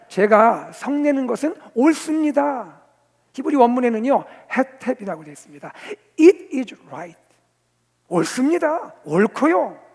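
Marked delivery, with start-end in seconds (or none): 0.1-2.8 loud, slow, high assertion; defiant answer. Acted. Preacher as prophet answering God, defiantly. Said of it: "요나가 하나님께 답을 합니다". 6.2-9.8 loud, emphatic assertion; self-righteous conviction. Acted. Preacher voicing the prophet's answer, with conviction. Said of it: "확신에 차 있습니다".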